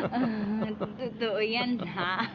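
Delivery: slow words through a giggle; laughing. Acted. Wife laughing as husband flatters her, slow giggling words. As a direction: laugh while asking if it's true.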